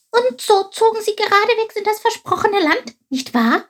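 very high, delighted little voice; joyful. A narrator's reading. Chilp expects the promised land next, joyful. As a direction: rejoice high up, eager for a yes. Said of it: "freute sich Chilp wieder".

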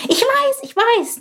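high, curious little bird voice; little sparrow voice. Acted. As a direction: chirp it like a small inquisitive sparrow. Chilp interrupts. (hohe, neugierige Spatzenstimme)

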